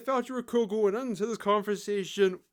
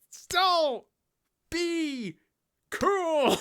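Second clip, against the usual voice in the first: high, loud, each word drawn out alone; mock cool slogan; 10 semitones higher, 4 dB louder, much slower than usual. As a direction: proclaim it word by word, sarcastically grand.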